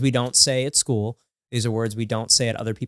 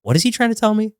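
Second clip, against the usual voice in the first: high-pitched, louder mock bewilderment; baffled plea; 11 semitones higher, 5 dB louder, faster than usual. Parent on FaceTime begging for help with child's words, baffled. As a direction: plead for a translation, voice way up. Puzzled parent asking for translation.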